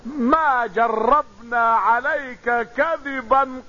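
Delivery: very slow, word-by-word declaration; crowd's united testimony. Retold or imitated. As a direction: spell out the crowd's verdict, drawing out each word. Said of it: "قالوا جميعا بلسان واحد". (شهادة جماعية ببطء شديد)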